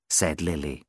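ordinary delivery

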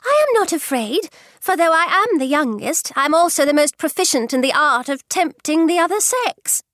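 put-on high, bold young girl's voice; stout. A narrator's reading. Youngest daughter boasting to her mother, bold and high. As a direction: answer boldly and confident. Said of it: "said Lydia stoutly".

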